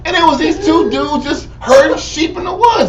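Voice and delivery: high, loud, laughing outburst; excited laughing rant. Real, not acted. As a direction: laugh and shout through the story.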